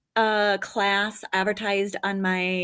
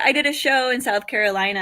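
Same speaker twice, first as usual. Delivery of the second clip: usual speaking voice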